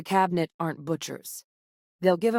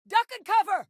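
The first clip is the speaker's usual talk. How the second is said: very high, urgent combat yell; urgent warning cry. Acted. Companion warning allies in combat, urgent cry. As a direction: cry out to make them drop.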